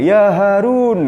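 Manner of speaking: cheeky holy fool hailing the caliph; calling out. Acted. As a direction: hail the king from afar, stretching every syllable. Bahlul calls out to the king.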